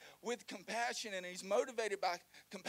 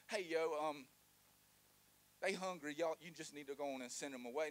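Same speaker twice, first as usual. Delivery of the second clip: casual hangry disciples; 5 semitones lower, 3 dB quieter than usual. Acted.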